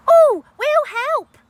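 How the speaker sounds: put-on high, loud little boy's voice; squeaky eager boy offering help; eager child. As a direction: jump in like an excited kid.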